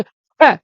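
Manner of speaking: स said loud and slow, hissing sharply; sharp स sound. Shown as a demonstration. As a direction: hit स hard with a sharp hiss. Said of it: "तेज करके स". (स को तेज़ और ज़ोर से बोलें)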